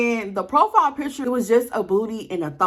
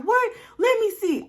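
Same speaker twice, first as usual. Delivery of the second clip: startled; 13 semitones higher than usual. Retold or imitated. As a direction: caught out, grab for the phone. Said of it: "He was like".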